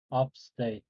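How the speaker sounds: ordinary talk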